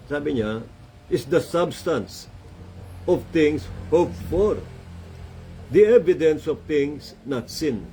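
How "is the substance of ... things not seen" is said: slow, deliberate reading of the verse, word by word; slow scripture reading. Acted. Preacher slowly reading Hebrews to the congregation, solemn. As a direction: read it out like holy writ, slowly.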